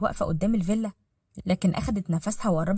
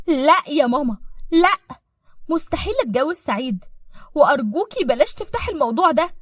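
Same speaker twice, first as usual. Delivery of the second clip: higher, louder firm refusal; entschiedene Ablehnung; 6 semitones higher, 5 dB louder than usual. Acted. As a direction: shut the proposal down emphatically. Tochter weist Heiratsvorschlag entschieden zurück.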